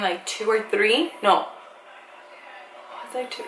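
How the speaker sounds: ordinary talk, nothing marked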